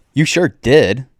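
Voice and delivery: loud, thrilled yell; excited shout. A narrator's reading. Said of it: "I shout with excitement".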